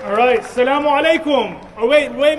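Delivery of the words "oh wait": usual speaking voice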